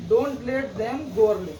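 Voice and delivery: slow dictation pace so students can write it. Shown as a demonstration. Teacher reads the example sentence to the class, slowly. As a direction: read out slowly, word by word, for copying. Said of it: "चलो, the principal said".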